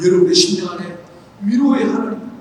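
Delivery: ordinary talk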